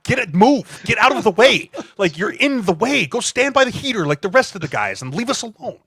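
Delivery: high, loud get out of the way; angry shooing. Retold or imitated. Lineman chasing the kicker away from the bench, loud and high.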